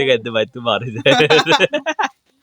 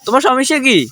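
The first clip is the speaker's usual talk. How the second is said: angry scolding; 11 semitones higher, 4 dB louder than usual. Real, not acted. Woken by shouting kids, scolds them loudly. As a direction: tell them off for the racket.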